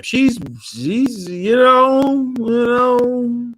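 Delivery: high teasing hesitation; coy high gossip voice. Acted. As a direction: squeak it up like spilling tea.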